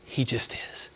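soft whispered parent; whispered answer. Retold or imitated. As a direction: breathe the answer gently.

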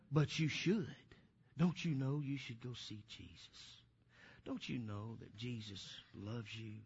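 very soft, low and hushed; imitated serious child. Little girl asking a supermarket stranger, hushed and serious. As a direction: gently imitate an earnest child, almost under the breath. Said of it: "she's just as serious".

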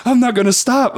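high quote through a laugh; laughing mock quote. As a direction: laugh while voicing the tram driver. Quoting Manny laughing.

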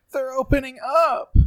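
loud, very high falsetto with a long held "up"; hammed-up high wail, drawing out the last word. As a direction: belt out the impression in a high voice. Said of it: "or should I say".

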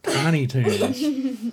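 amused slow repeat; laughing. Real, not acted. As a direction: laugh through the words. Repeating the show name amused.

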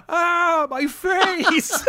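high hammed-up cry of pain; mock pained cry. Villain mocked crying out about his face. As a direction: overact the villain's scream for the film. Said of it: "and then he goes".

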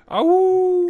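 high, loud howling Ow; jackal howl. Acted. Spooky howl to close.

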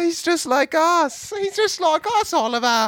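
high gushing fan. Pretend. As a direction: gush like an adoring fan.

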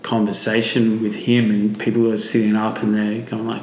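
ordinary talk, nothing marked

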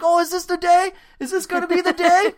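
high put-on comic dad voice; imitating the skit. Retelling the beer sketch to a friend, high put-on comic voice. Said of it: "He's like".

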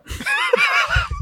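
very high shrieking laugh burst; delighted squealing laugh. Real, not acted. Wins race to answer.

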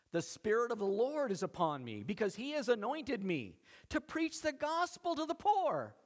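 high, ringing scripture proclamation; preaching declamation. Acted. Jesus declares his mission from Isaiah, proclaiming. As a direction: proclaim it like a herald, pitch lifted.

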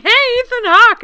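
high, loud fan greeting; excited greeting. Retold or imitated. Fans calling from the car window, excited. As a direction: call out to him like starstruck fans. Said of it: "we're both like".

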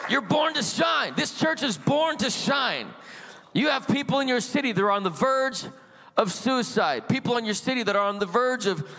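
fiery preacher at the climax; full-force preaching. Acted. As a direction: lift the congregation, proclaim it.

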